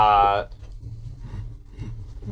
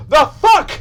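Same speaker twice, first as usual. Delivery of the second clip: high, loud startled blurt; changeling reacting to the Gazer cut in half; startled curse; 10 semitones higher, 9 dB louder than usual. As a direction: spit it out in shock. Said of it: "the changeling go".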